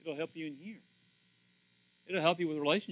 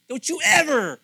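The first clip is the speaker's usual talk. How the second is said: mad nurse; 2 semitones higher, 10 dB louder than usual. Retold or imitated. Nurse scolding the pastor for moving to bed, loud and stern. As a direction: scold him for leaving the chair. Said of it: "She was so mad at me".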